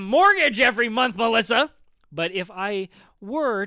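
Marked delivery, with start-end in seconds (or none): 0.0-1.7 suddenly shouting, bitter and high; angry outburst. Acted. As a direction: snap out the complaint, loud. Bitter about ex-wife's house.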